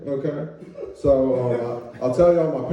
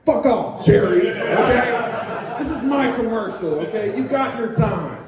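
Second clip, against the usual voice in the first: half-laughing fake snarl, higher pitched; mock angry brush-off; 6 semitones higher, 3 dB louder than usual. Akeem cutting off Jerry in his commercial, hammed-up anger. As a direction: tell him off like a cocky star cutting in. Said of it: "With, like, the same, like, intensity".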